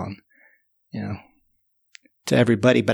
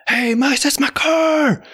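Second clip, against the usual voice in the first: shouting after them; 13 semitones higher, slower than usual. Retold or imitated. Owner on the bike yelling at the mice with his car.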